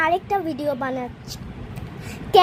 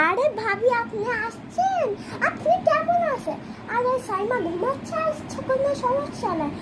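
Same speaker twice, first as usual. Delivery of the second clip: higher, syrupy welcoming voice; sweet welcoming mom; 6 semitones higher than usual. Acted. Mom greeting her sister-in-law, sweet and high. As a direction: gush over the visiting sister-in-law.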